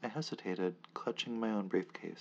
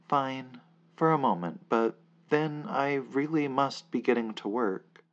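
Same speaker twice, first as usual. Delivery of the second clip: loud grudging agreement; 4 semitones higher, 8 dB louder than usual. Acted. Narrator reluctantly gives in, loudly.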